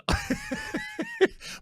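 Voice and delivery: high laughter bursting out; real laugh. Real, not acted. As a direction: break into helpless laughing. Laughing at brother's confession.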